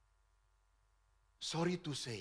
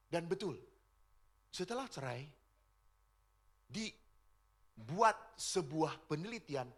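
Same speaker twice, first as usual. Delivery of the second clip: ordinary talk, nothing marked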